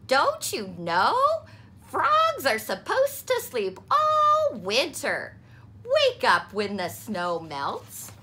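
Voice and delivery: mother explaining winter to Froggy. A narrator's reading. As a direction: patiently set him straight.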